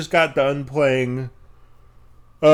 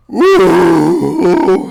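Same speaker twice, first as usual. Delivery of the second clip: loud cheering woo; loud happy woo; 3 semitones higher, 10 dB louder than usual. Real, not acted. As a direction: let out a loud whoop. Excited cheer.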